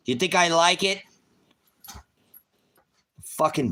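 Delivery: high, loud, bitter retort; angry outburst. Real, not acted. Defending his cursing to critics, angry outburst. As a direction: throw it back at them.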